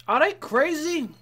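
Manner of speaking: high, slow disbelief; incredulous. Real, not acted. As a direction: ask it in slow amazement. Incredulous at robbers.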